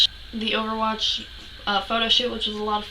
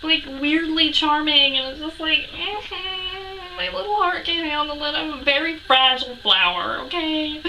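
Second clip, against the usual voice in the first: high, drawn-out fangirl squeak; pitched up, helplessly adoring; overwhelmed gushing; 6 semitones higher than usual. Acted. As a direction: play the fragile, smitten fan. Gushing over meeting her idol.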